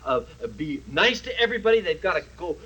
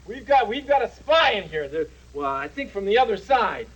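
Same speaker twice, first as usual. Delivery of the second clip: normal talking voice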